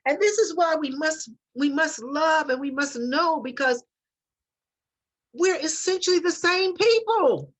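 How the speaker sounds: rising, passionate plea; impassioned. Real, not acted. Earnest plea about love and truth.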